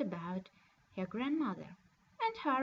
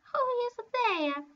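put-on much higher, louder old lady calling out; old grandmother calling; 10 semitones higher, 6 dB louder than usual. Grandmother calling to the knock at the door, high and loud. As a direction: call through the door like a frail granny. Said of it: "asked the old lady".

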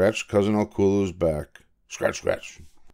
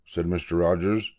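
plain reading voice

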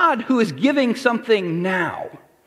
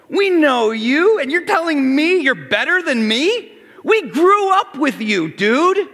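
higher, louder mocking neighbours; scoffing hometown crowd; 5 semitones higher, 3 dB louder than usual. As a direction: needle him like old neighbours who knew him. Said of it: "They said".

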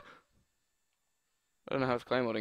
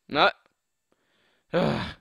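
usual speaking voice

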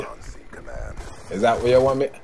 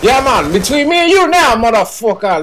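high, loud confrontational call; loud challenge; 8 semitones higher, 5 dB louder than usual. Real, not acted. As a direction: square up to a rival loudly. Challenging a trash-talking opponent.

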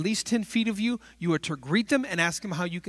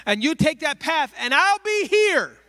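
breezy directions; 7 semitones higher, 5 dB louder than usual. Retold or imitated. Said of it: "We say".

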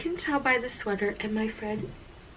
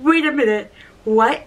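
loud, high double take; stunned disbelief; 4 semitones higher, 6 dB louder than usual. Retold or imitated. Fan stops the celebrity's video message, stunned disbelief. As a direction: halt everything in mock shock.

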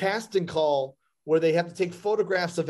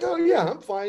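eager; 9 semitones higher than usual. Retold or imitated. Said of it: "I was like".

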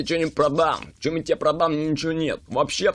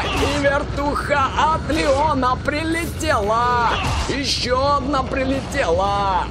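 excited gamer; 7 semitones higher than usual. Real, not acted. Cheering kills.

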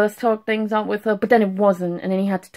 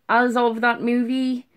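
usual speaking voice

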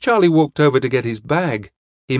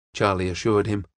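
usual speaking voice

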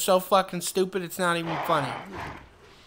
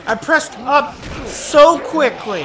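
whiny frustration; 6 semitones higher, 9 dB louder, slower than usual. Real, not acted.

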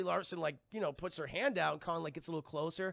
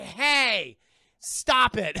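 loud mock scolding; hammed-up indignant shout; ape protesting; 4 semitones higher, 13 dB louder, much slower than usual. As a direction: yell it out, drawn then punched. Acting annoyed ape.

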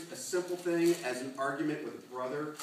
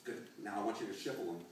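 usual speaking voice

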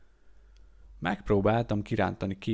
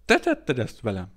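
accusing; 7 dB louder than usual. A narrator's reading. Maimed man accuses narrator.

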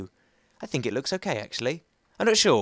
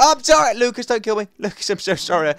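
mock pleading; 6 semitones higher, 6 dB louder than usual. Acted plea to Lucas for mercy.

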